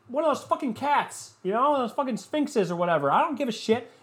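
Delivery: high, hammed-up ranting demand; mock yelling at her. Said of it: "You're just yelling at her".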